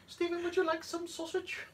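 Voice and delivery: very high-pitched playful offer; silly high voice. Pretend. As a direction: offer it in a comic squeak. Jokey offer after sausage joke.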